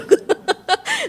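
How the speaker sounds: bright high-pitched laugh. Real, not acted. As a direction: laugh at the memory. Laughs at childhood memory.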